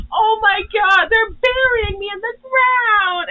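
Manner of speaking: hammed-up horror at being buried; panicked. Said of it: "reminds me of that scene in Steven Universe".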